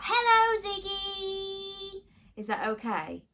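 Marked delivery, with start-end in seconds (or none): 0.0-2.0 shout it out. Shown as a demonstration. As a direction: call out Hello, Ziggy across the room. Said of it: "can you shout".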